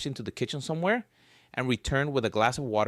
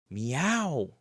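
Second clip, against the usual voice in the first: cat meow; 2 semitones higher than usual. Acted. Cat meows at Ellen.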